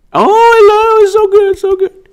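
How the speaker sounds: gleeful squeal. Real, not acted. Vlogger squealing with joy over his own impression. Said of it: "delighted squeal".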